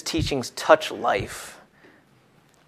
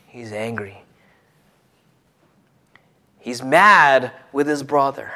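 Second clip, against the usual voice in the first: ordinary talk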